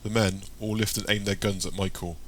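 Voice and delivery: plain reading voice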